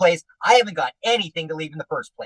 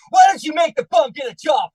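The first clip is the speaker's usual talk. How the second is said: much higher, louder indignant yell; screaming; 10 semitones higher, 4 dB louder than usual. Acted. Husband ranting at wife about a future husband, screaming. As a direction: bellow it in outrage. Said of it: "Enough screaming like that".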